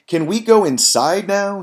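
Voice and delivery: put-on high, loud plea from a bored boy; acted kid pleading. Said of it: "He wants to be inside".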